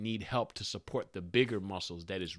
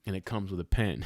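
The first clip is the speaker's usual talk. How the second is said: usual speaking voice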